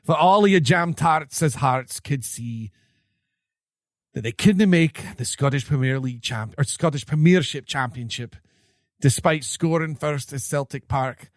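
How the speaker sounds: hammed-up Scottish brogue; Scottish accent. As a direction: read it like a Scottish pundit. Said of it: "it was hard in a Scottish accent to read".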